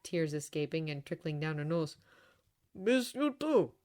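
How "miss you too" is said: slow, choked-up reply. A narrator's reading. Father answering tearful daughter, slow and choked. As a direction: hold back tears as you say it. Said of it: "said Mister Lestrade in choked voice".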